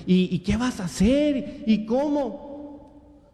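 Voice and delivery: worried woman. Retold or imitated. Preacher imitating a lady fretting over his lost job, worried questioning. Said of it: "Una señora así".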